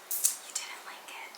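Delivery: slow, very soft whisper; hushed, drawn-out mock complaint; acted whisper. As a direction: whisper it slowly, as a let-down viewer. Acting out let-down viewer.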